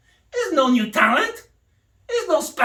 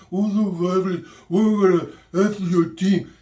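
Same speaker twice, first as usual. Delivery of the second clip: lower, put-on English accent mocking the coach; 6 semitones lower than usual. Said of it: "He talks in that accent".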